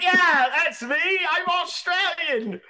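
high, hammed-up Australian accent with laughter. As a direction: insist in a comically bad Australian voice. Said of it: "You don't sound Australian".

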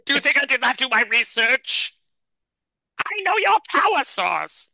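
high, strange alien creature voice; chameleon voice. Acted. Alien chameleon talking down to Gary, high and scornful. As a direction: sneer down at the puny humans.

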